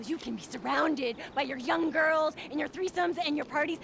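ordinary talk, nothing marked

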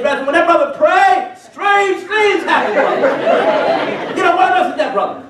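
high, slow mock awe; hammed-up street guy spooked; awed warning. Street guy warning the gang about the Bible man.

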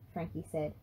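ordinary delivery

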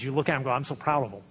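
usual speaking voice

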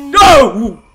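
sudden loud cry of no; startled yell. Real, not acted. Player cut off by the monster, startled yell. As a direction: burst out in alarm.

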